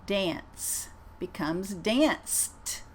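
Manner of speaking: "danced" repeated very slowly with a crisp T; second very slow model of "danced". Shown as a demonstration. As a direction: land the T in "danced". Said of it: "I'm gonna say them twice".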